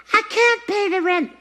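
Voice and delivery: high helpless damsel voice; damsel in distress. Acted. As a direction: wail it like a melodrama heroine. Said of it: "This is a hair bow".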